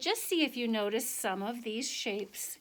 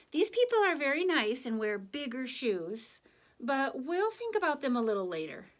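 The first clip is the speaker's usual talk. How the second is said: put-on lively, higher read-aloud voice for children; story narration; 4 semitones higher, 4 dB louder than usual. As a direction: tell it to the kids with a sense of wonder. Reads picture book to class.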